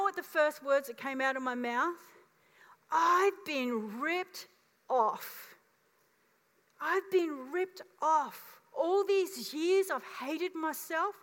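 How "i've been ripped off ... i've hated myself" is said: slow, higher, aching realization; a touch of grief at lost years; overwhelmed. Retold or imitated. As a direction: let the hurt dawn slowly.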